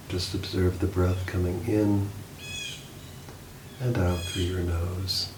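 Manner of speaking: soft, unhurried meditation voice with long pauses; calm, hushed guide easing listeners toward sleep; guided meditation. Acted. Leads a guided body scan.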